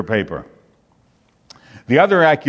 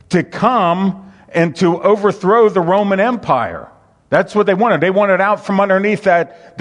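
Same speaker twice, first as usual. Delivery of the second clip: high, loud, driving sermon voice; preaching at full force; 7 semitones higher, 3 dB louder than usual. Acted. Preacher to congregation, raised and fervent. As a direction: preach it hard and rising to the church.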